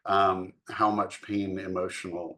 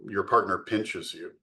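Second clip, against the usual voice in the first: ordinary talk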